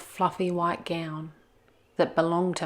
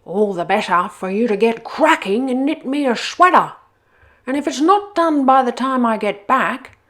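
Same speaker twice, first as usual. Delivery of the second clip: declaring wolf; 5 semitones higher, 9 dB louder than usual. Acted.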